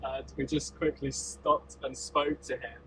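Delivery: ordinary talk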